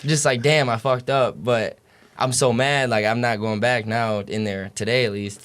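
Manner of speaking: ordinary delivery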